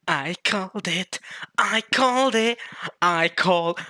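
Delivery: high, climbing excited chant; gleeful celebrating. Real, not acted. Fan boasting about a correct guess, real glee.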